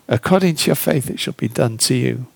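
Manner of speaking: hushed repeat. Acted. Preacher repeats Jesus' words to the congregation, hushed to a whisper. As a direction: let the repeat sink to a whisper.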